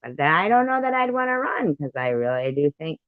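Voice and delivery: ordinary talk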